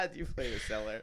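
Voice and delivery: laughing. Real, not acted. Repeating the quote laughing.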